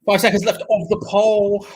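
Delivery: loud, slow announcer call; slow, loud countdown to viewers. Real, not acted. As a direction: boom it out like a countdown. Counting down the poll.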